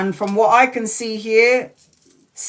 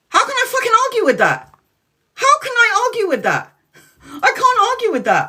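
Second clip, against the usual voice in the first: much higher, louder, fast exasperated outcry; 13 semitones higher, 3 dB louder, faster than usual. Retold or imitated. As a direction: throw your hands up, voice way up. Retold argument with dead friend.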